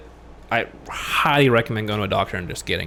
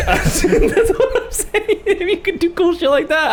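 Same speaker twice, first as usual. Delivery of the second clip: laughing through the words, much higher and slow; cracking up mid-sentence; slower than usual. Real, not acted. Laughing while arguing for Neuralink.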